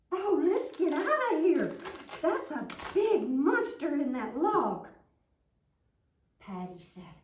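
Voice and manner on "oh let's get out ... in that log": high, loud panicked cry; scared cry. Acted. Friends fleeing the log monster, loud frightened cry.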